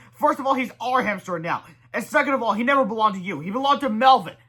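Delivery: put-on high, loud kid comeback; sassy kid voice. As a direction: talk back like a cheeky boy. Kid arguing with principal.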